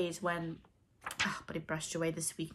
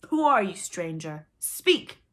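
demanding; 10 dB louder, slower than usual. A narrator's reading. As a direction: demand an answer from a stranger. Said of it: "Hook demanded".

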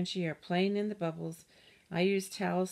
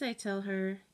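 plain reading voice

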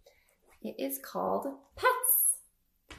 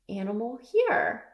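ordinary delivery

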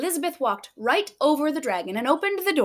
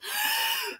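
high strained gasp with no fire; dragon gasp; 8 dB quieter than usual. Acted. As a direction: strain for a breath that fails. Dragon tries to breathe fire.

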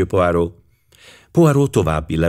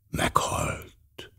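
hushed whisper, much softer and lower; very softly; 11 dB quieter than usual. A narrator's reading. Poirot echoes the news of the death in a whisper. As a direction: breathe the word almost soundlessly. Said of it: "mondta nagyon halkan Poirot".

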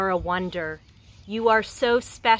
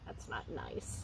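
breathy whisper, barely voiced; hushed aside; 13 semitones lower, 20 dB quieter than usual. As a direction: whisper it to yourself, hurt. Hurt boy mutters to himself.

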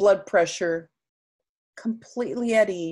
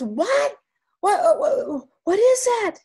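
very high, loud startled squawk; alarmed shock; 8 dB louder, slower than usual. Acted. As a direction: recoil in loud, high-pitched disbelief. Brother is shown the tiny monster.

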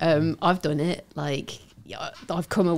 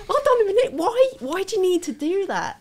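mock disbelief; 3 dB louder than usual. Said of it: "I was just like".

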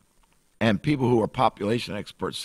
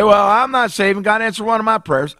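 high, loud put-on voice of a doubter; mock skeptic, pitched way up and louder; objecting sinner; 12 semitones higher, 6 dB louder than usual. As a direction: play the unsaved skeptic, raising your voice high. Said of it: "Now, he may say".

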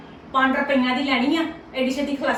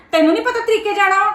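higher, louder taunting demand; angry taunting; 6 semitones higher, 4 dB louder than usual. Acted. Mother challenging her daughter's mother-in-law, loud taunt. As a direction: goad her to speak up, sharply.

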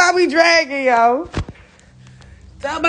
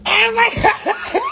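higher, slow excited shout; overjoyed exclaim; 8 semitones higher, slower than usual. Real, not acted. Touring her new house, a slow joyful shout. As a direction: let the joy ring out.

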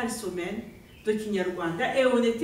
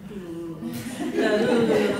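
laughing; slower than usual. Real, not acted. Laughing refusal to lose accent.

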